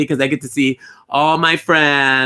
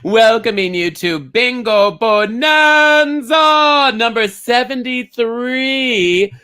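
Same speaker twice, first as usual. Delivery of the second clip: announcer; 6 semitones higher, 3 dB louder, slower than usual. Acted. Show host greeting.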